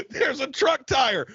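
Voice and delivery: high, slow alarmed yell; panicked shout. Acted. Acted dad panicking while driving.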